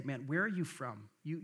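normal talking voice

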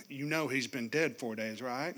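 low, needling reminder; guilt-trip him sweetly; passive-aggressive Martha reminding Jesus. Retold or imitated. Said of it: "Passive-aggressive Martha".

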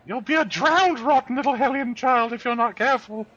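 high, loud put-on mother scolding; screechy-pitched hammed-up mum telling off; threatening mother. Mother threatening her hellion child, high hammed-up scolding.

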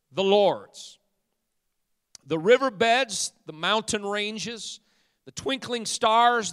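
high, loud declaiming with dramatic pauses; rolling pulpit oratory, item after item; full-force preaching. Real, not acted. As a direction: proclaim scripture to the whole congregation.

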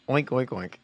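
mock pig oinking; pig oinks. Musician asked to squeal, oinks like a pig. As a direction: oink like a pig on request. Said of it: "a pig sound".

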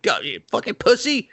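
high-pitched goading; reverse pep talk. Retold or imitated. Michael prodding his hesitant father, taunting pep talk. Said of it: "gives him a reverse pep talk".

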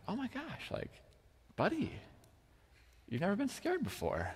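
soft, high-pitched sympathy; hushed, high voice of tender surprise; concerned father. Retold or imitated. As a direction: coo gently to a little boy. Father soothing his scared son.

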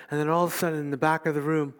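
ordinary talk, nothing marked

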